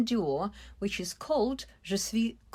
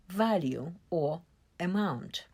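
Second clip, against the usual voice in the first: usual speaking voice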